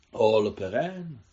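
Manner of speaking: pomalé, francouzsky znějící zvolání; vysloví to jako rodilá Pařížanka; francouzský přízvuk. A narrator's reading. Guvernérka mluví francouzsky. (guvernérka zvolá francouzsky s francouzským přízvukem)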